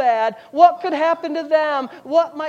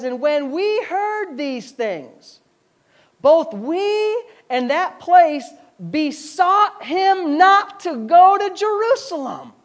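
dramatic scripture reading; 4 semitones higher, 4 dB louder, slower than usual. Acted. Preacher reads scripture dramatically.

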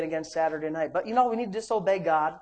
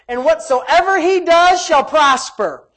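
loud, raised preacher's delivery; forceful preaching; 8 semitones higher, 9 dB louder, slower than usual. Real, not acted. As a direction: drive it home to the congregation. Climax of the verse.

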